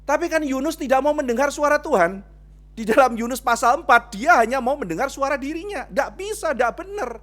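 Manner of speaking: high, loud sermon peak; fiery preaching. Acted. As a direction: preach it hard to the congregation. Preaching at full force.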